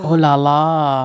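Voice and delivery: drawn-out mock-French exclamation; French accent. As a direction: coo it slowly in playful French. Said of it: "doing a French accent".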